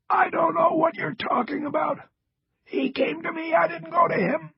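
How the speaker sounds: strained rasping protest; raspy. A narrator's reading. Injured man denies talking to the agent, raspy. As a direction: rasp it out insistently. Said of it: "Cross rasped".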